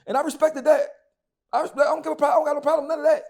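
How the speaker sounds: loud, high-pitched, emphatic concession; heated insistence. Real, not acted. Host conceding a point mid-rant about his rival, heated insistence.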